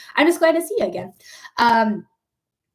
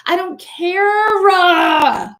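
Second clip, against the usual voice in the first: high, loud, drawn-out outburst; exasperated yell; 7 semitones higher, 6 dB louder, much slower than usual. Real, not acted. Streamer defending immigrants, exasperated yell. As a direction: blow up at the peak of the rant.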